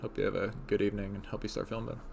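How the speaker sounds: ordinary talk, nothing marked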